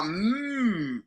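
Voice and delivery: MM as one long, soft hum; drawn M. Shown as a demonstration. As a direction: hold the M sound of MM out. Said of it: "you, you draw".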